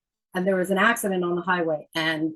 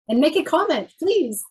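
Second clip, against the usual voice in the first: eager plea; 8 semitones higher, 4 dB louder than usual. Real, not acted. Asking viewers to comment.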